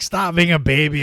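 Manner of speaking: loud, high, laughing taunt; wife mocking her crying husband, loud laughing taunt; mocking scold. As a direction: scold him through a laugh, loudly. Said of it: "And she's like".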